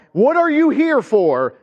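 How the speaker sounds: panicked demon. Retold or imitated. Demon freaks out in synagogue.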